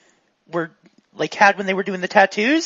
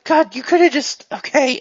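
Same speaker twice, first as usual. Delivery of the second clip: exasperated; 6 semitones higher than usual. Acted. Shot customer protests.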